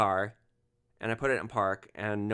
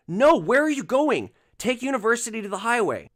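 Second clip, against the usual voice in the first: much higher and louder, frantic shout; flipping out; 11 semitones higher, 8 dB louder than usual. Retold or imitated. Passenger shouting at driver about the route, frantic anger. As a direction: yell at the driver for turning the wrong way. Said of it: "this guy flips out".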